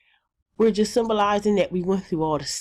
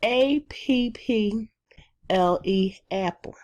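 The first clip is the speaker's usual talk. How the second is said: letters spelled out slowly; slow letter spelling; 2 semitones higher, slower than usual. Shown as a demonstration. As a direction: spell each letter deliberately, then the word. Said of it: "at the end, we would say".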